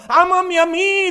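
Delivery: high, loud commanding "Ámame a mí"; booming divine command; voice of God. Retold or imitated. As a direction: proclaim as God demanding love. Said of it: "dice Dios".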